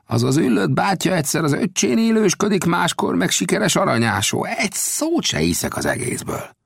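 put-on Old Death doubting the gambusino's tale; Old Death's voice. A narrator's reading. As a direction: scoff at the story.

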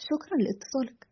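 understated, resigned let-down tone; helpless disappointment. A narrator's reading. Host ending a failed call, helplessly disappointed. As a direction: thank them with quiet, helpless disappointment. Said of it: "بنبرة عاجزة مشبعة بالخيبة".

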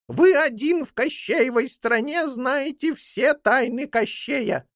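high-pitched talking bird telling her tale; old jackdaw, earnest. Acted. Old jackdaw reporting to her master about his jailed son, earnest. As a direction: report eagerly in a high character voice.

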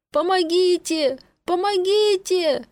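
high, slow desperate calls; desperate cry for help. A narrator's reading. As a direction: yell for help with everything. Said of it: "заревел он во весь голос". (отчаянный крик о помощи)

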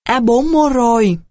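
restrained call through cupped hands; mild narrated shout. A narrator's reading. Trần Triện calls to missing squad four, mild shout. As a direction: call out loud, but keep it in storytelling voice. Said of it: "gào thật to".

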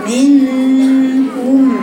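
منكم with the نون hidden in a long held nasal hum; drawn-out nasal ghunna. Shown as a demonstration. Teacher modelling منكم to her student with a long nasal ghunna. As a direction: stretch منكم slowly, the ghunna humming from the nose. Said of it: "شايفة الصوت؟".

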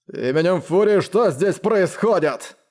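narrator's contained version of a thundering bellow; thunderous roar. A narrator's reading. Commander demanding to know what is happening, roaring. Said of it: "взревел он громовым голосом".

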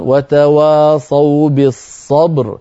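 بالصبر recited slowly, ending on a thick, full ر; slow tajweed recitation with a heavy ر at the stop. Shown as a demonstration. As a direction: demo of tafkhim on بالصبر. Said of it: "أيضًا".

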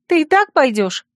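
high, incredulous cry; alarmed question. A narrator's reading. Friend points at the slipping T-shirt, asking in alarm. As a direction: ask in high-pitched disbelief. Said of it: "крикнула Царья".

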